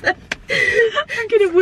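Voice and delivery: wheezing through real laughter, dragged out slowly; barely getting the words out for laughing; laughing helplessly. Real, not acted. [name], creased up at her friend's story, laughing helplessly.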